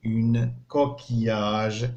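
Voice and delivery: coquillage said very slowly, syllable by syllable; teacher modelling French; slow model word. Shown as a demonstration. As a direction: stretch coquillage out for counting. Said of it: "شحال فيها من مقطع صوتي".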